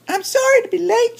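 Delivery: very high, squeaky little chipmunk voice; tiny chipmunk voice. Acted. Chipmunk greeting Bear at the party, very high squeaky voice. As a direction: pitch way up like a small sweet chipmunk.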